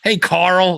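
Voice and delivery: Carl called out high and loud with a huge R; super heavy R. Retold or imitated. Calling to housemate, super heavy R in Carl. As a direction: hail Carl with an over-rolled American R sound. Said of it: "like, super duper heavy R".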